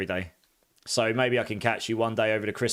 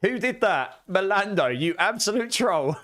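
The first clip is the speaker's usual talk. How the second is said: laughing outrage; 8 semitones higher, slower than usual. Real, not acted. To a viewer who played music: laughing mock outrage. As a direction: call out the troll while laughing. Chat troll plays music.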